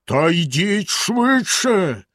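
villain's drawn-out shout; hollering. A narrator's reading. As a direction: yell the order, dragging it out. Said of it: "знову заволав синя борода".

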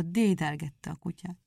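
usual speaking voice

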